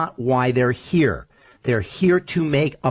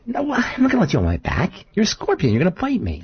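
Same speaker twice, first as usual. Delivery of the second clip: much higher, quick, wary frog refusing; 7 semitones higher, faster than usual. Acted. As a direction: squeak out the refusal like a nervous frog. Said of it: "And the f-, frog says".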